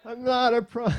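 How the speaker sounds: loud, high, cut-off protest; retold shout. Dad defending himself to hikers, retold shout. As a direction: imitate shouting it to everyone around. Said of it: "shouting it out".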